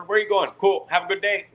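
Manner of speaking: high, light, easygoing officer voice; imitating a relaxed guard waving him through; breezy US border guard. To a Canadian traveller, the US guard sounds high and breezy.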